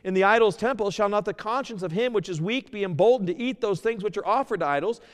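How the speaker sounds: loud, high preaching read; fervent scripture reading. Acted. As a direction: proclaim the verse to the congregation. Reads scripture at full preaching force.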